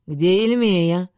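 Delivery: лениво растянутый вопрос, громче обычного; протяни медленно, будто между делом; ленивая растяжка. A narrator's reading. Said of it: "будто между делом лениво протянул". (медленно, с ленцой, нараспев)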